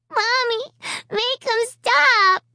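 put-on high, slow little-girl plea; a toddler begging her mom through sobs; sobbing child. A narrator's reading. Little Alicia sobbing for her mommy. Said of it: "the little girl was sobbing now".

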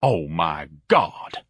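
slow, low, drawn-out dismay; appalled groan. A narrator's reading. Ignatius on hearing Mancuso's name, appalled.

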